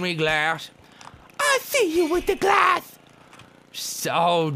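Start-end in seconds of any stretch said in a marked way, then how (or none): 1.4-3.0 very high loud squeaky voice; high squeaky girlfriend. Pretend. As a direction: play the jealous girlfriend flake, shrill and shocked. Girlfriend flake accusing.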